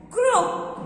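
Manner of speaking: teacher naming croak; stressed key word. Shown as a demonstration. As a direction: croak said high. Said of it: "the call of a frog is called".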